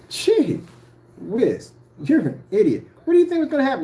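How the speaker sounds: high, loud scoffing at a fool; exasperated outburst. Real, not acted. Scolding Jamie on camera for calling it in, exasperated yell. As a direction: sneer at him like he's an idiot.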